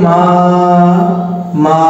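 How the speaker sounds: মা held long on the vowel; drawn out. Shown as a demonstration. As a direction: stretch মা out to show an open syllable. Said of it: "অনেক সময় ধরে টানা যাচ্ছে".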